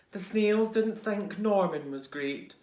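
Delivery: ordinary delivery, nothing marked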